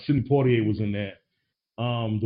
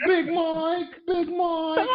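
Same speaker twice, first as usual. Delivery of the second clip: much higher, put-on voice crying out the name; doting mom impression; much slower than usual. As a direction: wail it like a sentimental mom. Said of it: "That impression was terribly accurate".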